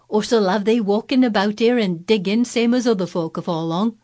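put-on cheerful Yorkshire country boy; Yorkshire accent. Dickon voiced, Yorkshire.

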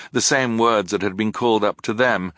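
ordinary delivery

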